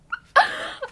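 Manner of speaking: a sudden high delighted squeal of a laugh. Real, not acted. Laughing at the brand sending her foundation, high squeal. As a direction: burst out in a shrill laugh.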